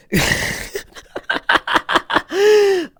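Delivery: high-pitched cackle; squeaky laughing fit. Real, not acted. Laughs at terrible setup.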